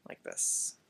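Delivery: ordinary talk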